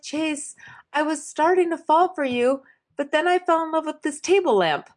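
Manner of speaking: high, gushing confession; hammed-up earnest declaration of love; mock lovesick woman. Imitating the woman's flighty confession.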